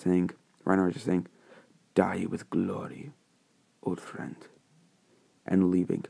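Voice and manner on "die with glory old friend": soft, slow parting words; hushed farewell. Acted. Reinhardt leaves his dying old friend, hushed farewell. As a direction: let it sink to a near-whisper.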